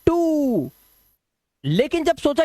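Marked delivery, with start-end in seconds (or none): 0.1-0.7 "टू" called out high; teacher answers his own question with "टू", high; answer drawn out. Shown as a demonstration. Teacher quizzing the class: "टू" sung out high. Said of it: "क्या हो गया?".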